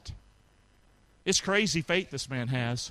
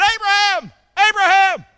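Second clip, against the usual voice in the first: calling out; 13 dB louder than usual. Acted. Angel calling from heaven.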